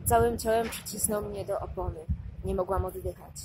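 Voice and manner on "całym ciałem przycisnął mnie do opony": ordinary delivery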